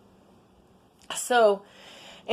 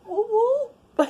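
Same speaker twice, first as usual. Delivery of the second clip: excited whoop; 4 dB louder than usual. Real, not acted. Cheering about her new camera.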